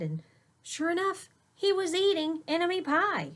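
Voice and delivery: usual speaking voice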